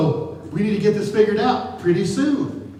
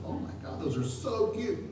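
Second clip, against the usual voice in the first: cooing; 5 semitones higher, 8 dB quieter than usual. Retold or imitated. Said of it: "I'm going".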